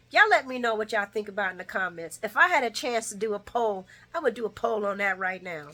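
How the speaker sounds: normal talking voice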